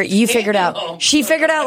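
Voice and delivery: normal talking voice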